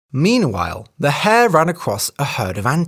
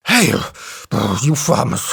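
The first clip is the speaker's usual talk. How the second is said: panting; 2 semitones lower than usual. A narrator's reading. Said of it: "he panted".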